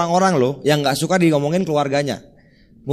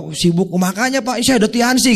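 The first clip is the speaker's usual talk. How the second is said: blurted pitch; 7 semitones higher than usual. Shown as a demonstration. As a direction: pounce on the chance, too eager. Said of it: "jangan tiba-tiba". (langsung menawarkan dengan semangat berlebihan)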